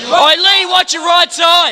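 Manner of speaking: high, slow warning yell; warning shout. Real, not acted. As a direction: call out the danger across the map. Warning teammate in firefight.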